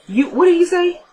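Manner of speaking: high, surprised question. Retold or imitated. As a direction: catch her out, eyebrows up. Lucian suspects Natalie's outburst.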